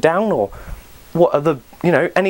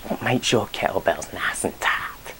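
soft, much quieter whispered tease; 7 semitones lower, 8 dB quieter than usual. Acted. Asking viewers about their kettlebells, teasing whisper. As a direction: lean in and whisper it suggestively.

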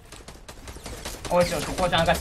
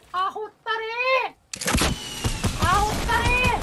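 very high, long-drawn cry; high wailed curse; much slower than usual. Real, not acted. Player cursing in the fight, high drawn-out wail. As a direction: stretch the insult into a thin high wail.

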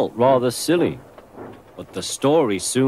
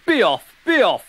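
Henry blustering at a cow; Henry bossing the cow; 5 semitones higher than usual. A narrator's reading. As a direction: try to scare her away.

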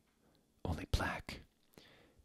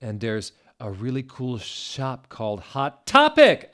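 loud, higher, building to a burst; happy; 4 semitones higher, 7 dB louder than usual. A narrator's reading. Finishing the news about Hot Topic, happy. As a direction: punch out the shop name with glee. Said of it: "happy again".

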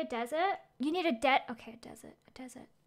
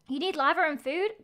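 usual speaking voice

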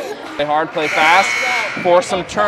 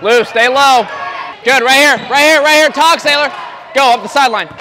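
loud, high coaching yells across the court; sideline shouting; 5 semitones higher, 5 dB louder than usual. Real, not acted. Coach yelling instructions to players on the court, loud. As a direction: call the plays out over the crowd.

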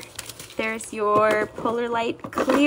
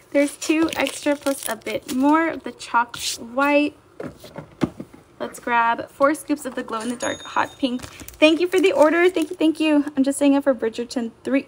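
usual speaking voice

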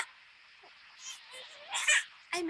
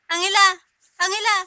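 calling out; 4 semitones higher, 10 dB louder, much faster than usual. Real, not acted. Calling out to baby.

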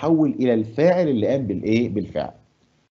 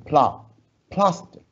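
"plastic" with PLA stressed high; first say "pla-" alone, then "plastic" leaning on PLA; 6 semitones higher, much slower than usual. Shown as a demonstration. Teacher shows pupils the stress in "plastic". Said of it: "مين الأقوى؟ الأولاني".

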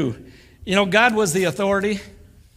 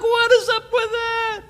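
much higher, squeaky outraged question; indignant disbelief; 4 dB louder, slower than usual. Retold or imitated. Churchgoer hearing of a pardon, squeaky indignant disbelief. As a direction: squeal it like you can't believe he got off. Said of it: "You're like".

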